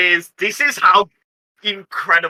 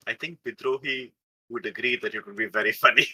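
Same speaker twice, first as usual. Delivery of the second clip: much softer, lower inner thought; thinking aloud; 7 semitones lower, 10 dB quieter than usual. Acted. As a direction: muse it to yourself, quietly. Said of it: "I will think".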